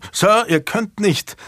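high, slow alarmed outcry; startled cry. Acted. Frightened squire cries out at the intruding knight. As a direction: squire startled as the door flies open. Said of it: "erschrocken ausrufen".

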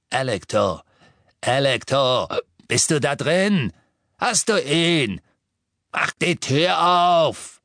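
coarse brute calling through a door, building; increasingly impatient yelling; coarse shouting. A narrator's reading. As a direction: start contained, rise to a high, drawn-out demand. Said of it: "die ordinäre Stimme von Amicus".

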